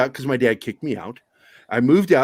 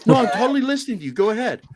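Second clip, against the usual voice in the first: fast fake innocence; 7 semitones higher, faster than usual.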